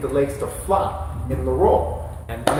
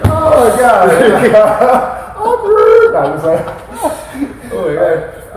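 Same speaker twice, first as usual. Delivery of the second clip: high, loud laughing through words; can barely speak for laughing; 11 semitones higher, 14 dB louder, much slower than usual. Real, not acted. Laughing at awkward moment.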